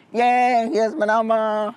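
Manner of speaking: high, bright and eager. Retold or imitated. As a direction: toss off the number cheerfully. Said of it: "And he's like".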